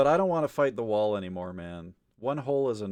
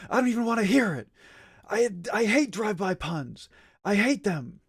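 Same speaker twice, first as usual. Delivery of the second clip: angry rant; 8 semitones higher, 3 dB louder than usual. Real, not acted. Ranting about drive-by puns.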